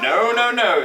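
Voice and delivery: a parent quickly forbidding something; papa's objection. A narrator's reading. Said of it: "said Papa".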